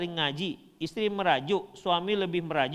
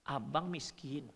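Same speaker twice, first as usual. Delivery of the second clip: softer, slow muttered "Abang miskin"; wife insulting calmly instead of shouting; quiet insult; 6 semitones lower, 10 dB quieter, slower than usual. Retold or imitated. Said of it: "betul-betul mencaci maki".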